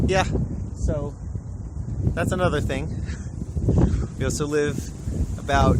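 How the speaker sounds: loud over strong wind; shouting over wind. Real, not acted. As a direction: raise your voice to carry over wind. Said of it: "I sure hope you can hear me over this wind".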